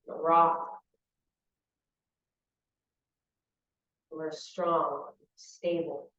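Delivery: calm visualization in short, spaced phrases; unhurried meditation teacher, phrase by phrase; guided meditation. Real, not acted. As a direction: guide them through the image, leaving long silences.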